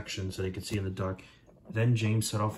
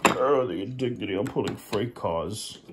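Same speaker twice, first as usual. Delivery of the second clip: Gordon's later voice; 3 dB louder than usual. Acted. Gordon complains about pulling freight cars, pompous and indignant. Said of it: "that's my later voice".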